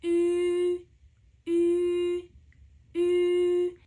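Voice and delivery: "ü" said loud with tight rounded lips, tongue forward; loud Mandarin ü. Shown as a demonstration. Teacher introduces "ü" with a loud, clear demo. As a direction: purse the lips like a fish on "ü". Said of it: "In Chinese, it's".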